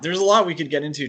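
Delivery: usual speaking voice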